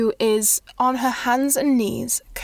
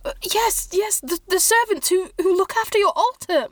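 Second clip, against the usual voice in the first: higher, stammering, hurried groveling voice; nervous monk; 5 semitones higher, faster than usual. Acted. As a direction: grovel and rush the apologies. Nervous monk questions lady, apologises.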